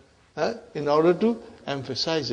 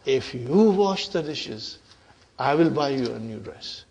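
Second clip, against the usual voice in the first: measured, lower-pitched conditional promise; spelled-out condition; 5 semitones lower, 3 dB quieter than usual. Shown as a demonstration. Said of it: "there is a condition hidden in it".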